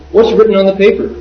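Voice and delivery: lightly imitated demanding question. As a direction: ask it like a nosy monk wanting to see. Monk accosting him in story.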